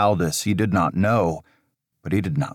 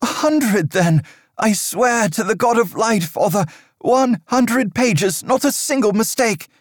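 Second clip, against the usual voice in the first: higher, raised pleading promises; desperate young monk; 3 dB louder than usual. Acted. Aldous begging Father Riker for another chance, desperate. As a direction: bargain frantically for forgiveness.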